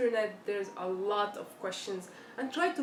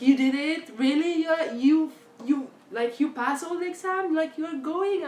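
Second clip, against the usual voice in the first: loud high delighted disbelief; thrilled mom; 7 semitones higher, 10 dB louder than usual. Retold or imitated. Mom overjoyed her daughter passed, excited. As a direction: gush with joy and disbelief.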